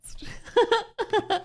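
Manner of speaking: very high giggling burst; laughing hard. Real, not acted. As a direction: crack up in a high pitch. Cracks up at repeated line.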